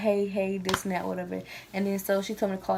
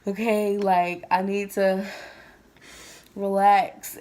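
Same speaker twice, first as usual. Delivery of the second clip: retold half-laughing, half-tearful self-talk, slower; talking yourself down through tears and a laugh; crying and laughing; 5 dB louder, slower than usual. To friends, retelling calming herself: mild laugh through tears. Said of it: "I was crying and laughing at the same time".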